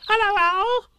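very high, loud excited squeak; little Roo squeaking at his first party; squeaky Roo. A narrator's reading. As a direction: squeak it like a tiny joey. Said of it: "he squeaked".

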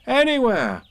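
put-on high, loud child's cry; carefree boy. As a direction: fling the answer out high and loud. Boy jumps up, happily answers.

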